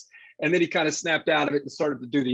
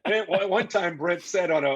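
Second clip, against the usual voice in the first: ordinary delivery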